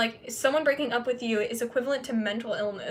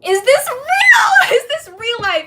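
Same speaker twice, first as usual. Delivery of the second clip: very high, loud, slow half-laughing cry; hammed-up shocked shriek; mock outraged shout; 12 semitones higher, 12 dB louder, slower than usual. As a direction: wail it in disbelief. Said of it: "I was just like".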